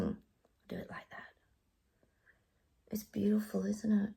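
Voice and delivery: soft whispered aside. Real, not acted. Vlogger whispering while showing butterfly close-up. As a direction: breathe it quietly.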